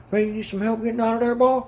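put-on high, fast, pushy good old boy; pushy country guy. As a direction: push your help on him like a pushy local. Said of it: "pushy about it".

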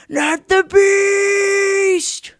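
long, high, sustained yell; drawn-out shout. Acted. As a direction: stretch it into a huge shout. Drawn-out show intro shout.

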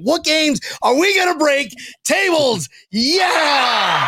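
high, building crowd-rousing yell; rallying hype shout. Real, not acted. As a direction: whip the fans up into a shout. Hyping up fans to party.

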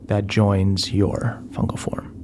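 ordinary talk, nothing marked

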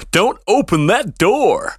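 slow, hammed-up game line; Barry Burton quote. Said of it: "you're thinking back to Barry Burton quotes now".

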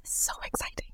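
breathy whispered aside. Real, not acted. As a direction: whisper it to yourself. Excited aside to herself.

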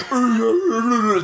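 high-pitched wordless mocking gibberish; mocking mimicry noise. Retelling the boy teasing his friend's voice, mocking babble. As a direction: babble it back high, making fun of his voice. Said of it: "just, like, makes fun of his voice".

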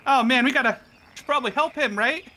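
high, quick, fretful voice; nervous worried guest; high nervous NPC voice. Acted. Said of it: "and he's like".

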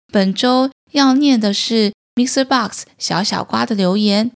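ordinary delivery, nothing marked

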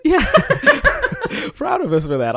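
giggling delight; laughing. Real, not acted. Proud of co-host, laughing out loud. Laughing at their own pride.

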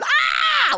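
sudden high excited scream. Retold or imitated. Imogen sees her friend arrive and screams excitedly. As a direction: shriek with joy at seeing a friend. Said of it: "screaming that there she is".

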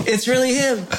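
high, giggling awe; starstruck, laughing; laughing disbelief. Retold or imitated. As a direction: laugh through a starstruck gasp. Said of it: "And I was like".

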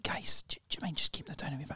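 whispered, timid plea to rowdy strangers; acted polite whisper; hushed polite request. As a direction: ask very softly, afraid to cause trouble. Politely asking noisy men to quieten.

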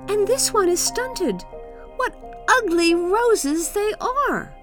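put-on high-pitched childish scorn; scornful boy. Kai finding fault with Gerda and the roses, scornful boy.

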